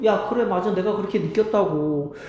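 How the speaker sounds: usual speaking voice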